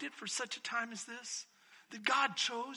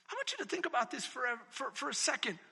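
ordinary talk

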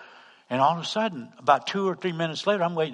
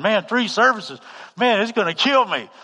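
higher, loud exclaiming; retold alarm; 8 semitones higher, 7 dB louder than usual. Preacher recalls thinking about three services, loud mock alarm.

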